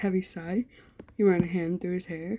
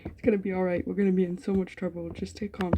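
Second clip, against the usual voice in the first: ordinary delivery, nothing marked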